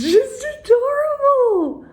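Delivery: high adoring squeal; high adoring coo. Real, not acted. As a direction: coo over something adorable. Gushing over gift.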